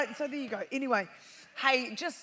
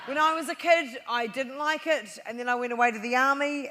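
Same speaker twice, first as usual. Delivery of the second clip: usual speaking voice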